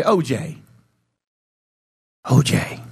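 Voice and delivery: low hushed calling voice, dropping to a whisper; voice of God calling. Acted. Preacher voicing God calling to a lost man, hushed and low.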